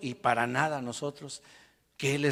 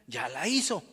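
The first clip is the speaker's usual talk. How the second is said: normal talking voice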